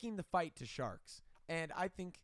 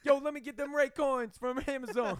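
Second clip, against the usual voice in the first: loud, higher street-guy voice calling out; mock delivery guy; 6 semitones higher, 6 dB louder than usual. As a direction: bark it like a pushy delivery bro. Mock character.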